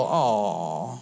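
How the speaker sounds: low, drawn-out pitying sigh of a word; mock sympathy. Someone sitting with you in your troubles, mock sympathy. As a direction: let it sag in exaggerated pity. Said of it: "sit with you and go".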